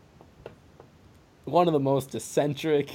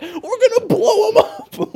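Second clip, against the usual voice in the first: much higher, loud, laughing cartoonish glee; gleeful warmonger; 8 dB louder than usual. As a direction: squeal it like a kid with a bomb. Voicing gleeful warmonger actor.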